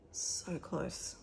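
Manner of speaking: breathy whispered aside. Real, not acted. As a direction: whisper it to the camera, almost secretly. Almost finished the challenge.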